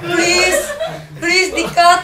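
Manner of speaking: high, loud and laughing with embarrassment; embarrassed laughing plea. Real, not acted. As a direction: beg through giggles, flustered. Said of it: "Malu banget".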